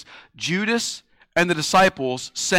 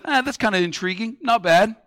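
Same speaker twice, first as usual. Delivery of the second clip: mildly impressed, noncommittal, pitched up; half-hearted interest; 4 semitones higher than usual. Acted. Preacher mimicking a lukewarm response to the gospel, half-hearted. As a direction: shrug it off with lukewarm approval. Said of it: "with measly sort of".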